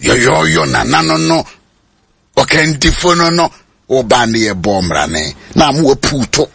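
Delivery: ordinary talk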